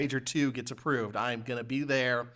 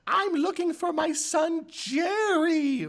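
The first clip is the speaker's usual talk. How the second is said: put-on high, loud falsetto old woman asking for her son; mock game voice; 5 dB louder, much slower than usual. Mocking the game's bad voice acting, falsetto plea. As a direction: ham up the game's old lady. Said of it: "Jerry was the best name".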